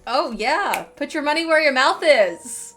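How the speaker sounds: normal talking voice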